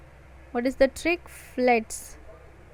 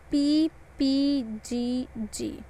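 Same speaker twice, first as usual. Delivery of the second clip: slow trick; slower than usual. Shown as a demonstration. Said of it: "here the trick goes".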